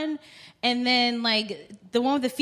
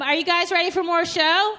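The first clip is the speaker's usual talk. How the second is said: higher, shouted host call; hyping the crowd; 6 semitones higher than usual. Real, not acted. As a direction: rouse the audience for the next act. Host rousing audience for next act.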